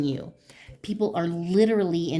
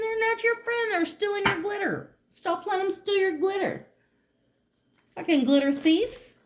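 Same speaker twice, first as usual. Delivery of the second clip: worked-up rant; 13 semitones higher than usual. Real, not acted. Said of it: "I'm getting mad about your glitter".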